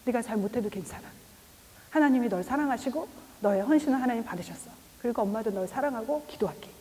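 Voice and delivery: soft, tender reassurance to a daughter; gentle comfort. Retold or imitated. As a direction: comfort her quietly and softly. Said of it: "아니죠". (딸을 다정하게 달래며)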